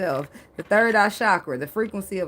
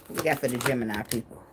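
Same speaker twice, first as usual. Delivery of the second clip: lower, fast, playful put-on voice; a quick mock-gruff joke voice; joking voice; 7 semitones lower, 4 dB quieter, faster than usual. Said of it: "I'm just playing".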